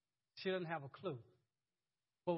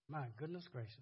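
awed hush; 6 semitones lower, 8 dB quieter than usual. Real, not acted. Marvelling at the verse.

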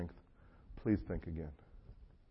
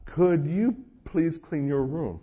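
dragged-out, pointed ask with weight on you; mock patient dad, slowed way down; slow pointed request; 5 semitones higher, 3 dB louder, slower than usual. Dad answering the kid.

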